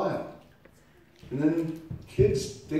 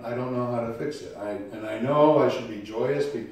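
normal talking voice

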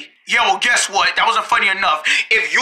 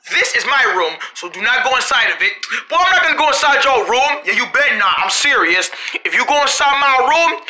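ordinary talk, nothing marked

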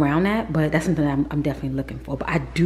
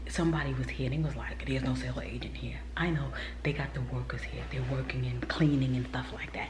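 whisper; 3 semitones lower, 11 dB quieter, faster than usual. Real, not acted. Whispering on a house tour.

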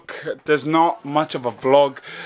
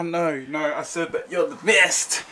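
ordinary talk

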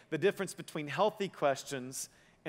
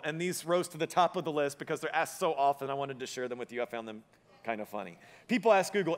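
normal talking voice

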